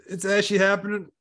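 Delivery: high strained voice; high pained reply. Retold or imitated. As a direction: say it in rising panic.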